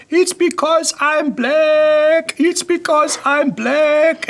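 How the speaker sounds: high, slow, wailing mock scream; imitated screaming. Woman without a passport screaming at police, high slow wail. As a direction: shriek it out like the lady at the airport. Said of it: "she's shouting and screaming in that, in that accent".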